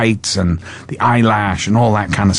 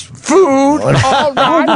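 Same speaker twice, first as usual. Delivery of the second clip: much higher, hammed-up old man voice; imitating the writer; much slower than usual. Actor mimics costar as the writer at dinner, high hammed-up voice. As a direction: ham up the writer leering at the guest. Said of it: "comes down and when I'm eating and goes".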